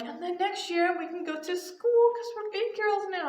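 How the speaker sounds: high child voice, lightly imitated; retold little-girl excitement; excited little kid. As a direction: announce it like a proud small child. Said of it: "so excited".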